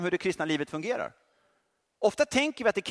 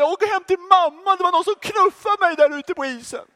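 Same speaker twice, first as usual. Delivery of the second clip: much higher and louder, a hammed-up whining player; mock whiny crybaby; 11 semitones higher, 6 dB louder than usual. Hockey player whining to the coach, hammed-up crybaby. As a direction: whine like a sulking child running to mum. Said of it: "börjar grina och säger".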